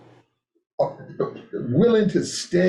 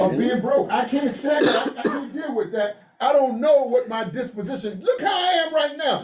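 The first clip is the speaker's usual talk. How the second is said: high-pitched, worked-up protest; agitated insistence; 6 semitones higher than usual. Real, not acted. Speaker recoiling at being broke, agitated insistence. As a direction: push the voice up in exasperation.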